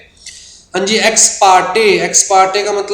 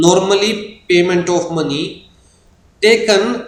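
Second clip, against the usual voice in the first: slow dictation; 2 semitones higher, much slower than usual. Shown as a demonstration. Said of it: "reads definition slowly".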